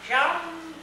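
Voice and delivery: high faint sighed Jum; weary sighing voice. A narrator's reading. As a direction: breathe it out like a fading sigh. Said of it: "a weary and wandering sigh".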